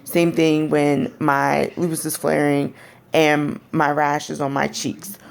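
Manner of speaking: ordinary talk, nothing marked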